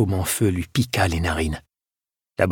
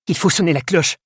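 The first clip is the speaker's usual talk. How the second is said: high-pitched, desperate plea; 9 semitones higher than usual. A narrator's reading. Edgar insisting the bell must be rung, desperate. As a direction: plead in despair. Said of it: "dit-il au désespoir".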